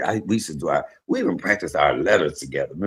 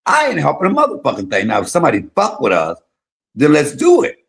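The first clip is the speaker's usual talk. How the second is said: raised, firm and higher, laying down the law; loud defiant teen warning; defiant retold warning; 6 semitones higher, 5 dB louder than usual. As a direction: tell the troublemaker you won't be bullied. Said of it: "So I said".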